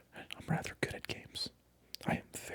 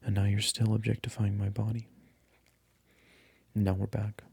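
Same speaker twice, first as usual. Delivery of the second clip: voiced, gentle ASMR murmur, close to the mic; soft-spoken ASMR voice; 3 semitones lower, 7 dB louder than usual. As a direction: switch from whisper to soft voiced talk, still scolding. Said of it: "we're going to make the switch to soft-spoken".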